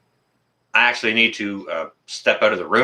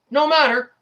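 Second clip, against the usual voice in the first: sarcastic. Real, not acted. Sarcastic mimicry of rules.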